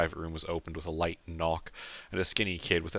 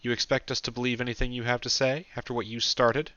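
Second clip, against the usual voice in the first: higher-pitched, hostile challenge; 6 semitones higher, 4 dB louder than usual. A narrator's reading. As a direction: challenge her with open distrust. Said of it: "fixing an unpleasant stare on Karen".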